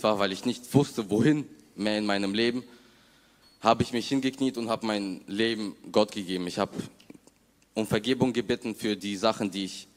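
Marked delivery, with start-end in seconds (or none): none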